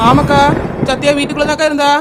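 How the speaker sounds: loud reply across the water; shouting back. Acted. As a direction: call back so she hears. (தூரத்திலிருந்து சத்தமாக பதில்)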